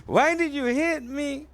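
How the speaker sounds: high, drawn-out tearful wail; mock crying. Beaten heart asks the asshole, mock crying. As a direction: whimper it high and slow, hammed-up. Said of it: "the heart's crying like".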